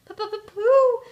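high, loud stammer of terror; squeaky, trembling little Piglet; trembling fright. A narrator's reading. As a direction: stammer the first sound in a panic. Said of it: "whispered Piglet, trembling".

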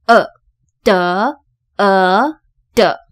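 呃 and 的 as separate schwa syllables; sounding out schwa. Shown as a demonstration. Teacher demonstrating the lazy vowel, sounding out 呃, 的. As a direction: sound out 呃, then blend it into 的. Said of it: "它是念成懒人音".

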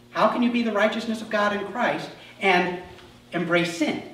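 ordinary delivery

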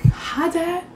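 high, small innocent voice; meek greeting. Retold or imitated. Caught sneaking in, greeting her dad in a small high voice. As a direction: squeak it out like a caught kid.

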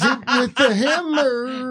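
hammed-up impression of a woman; imitazione di una donna. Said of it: "What's the word she says".

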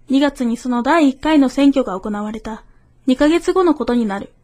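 ordinary talk

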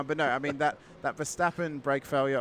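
normal talking voice

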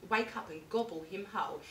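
usual speaking voice

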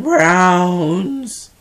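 loud, drawn-out exclamation; mock complaint. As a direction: belt out the word in comic protest. Mock complaint about her sign's colour.

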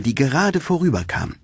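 ordinary delivery

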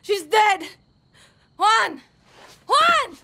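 frantic grief-stricken calls; anguished shout. Acted. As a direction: yell his name through grief. Calling comrade after death.

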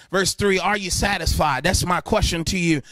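ordinary delivery

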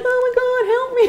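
very high, laughing mock cry for help; hammed-up high plea; mock trapped victim.

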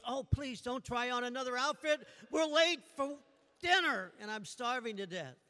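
high, comically desperate complaint; exasperated plea. Acted. Husband begging wife not to change outfits, mock exasperated. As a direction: plead impatiently, peaking on "late".

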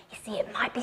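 hushed secret whisper. Acted. Storyteller builds secret.

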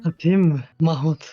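loud faked male voice; forced deep voice. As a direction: boom it out like a guy. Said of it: "Ben de kız olup erkek sesi çıkarıyorum".

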